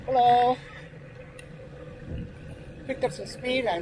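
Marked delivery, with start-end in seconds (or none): 0.1-0.6 calling out. Real, not acted. Calling out to someone on path.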